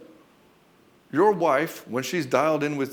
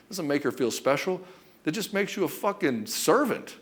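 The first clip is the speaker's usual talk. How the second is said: ordinary talk, nothing marked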